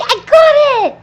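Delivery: loud, high victory yell; triumphant shout. Real, not acted. Streamer finally saying the word, yelling in victory. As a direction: shout it like you just won. Triumph after many tries.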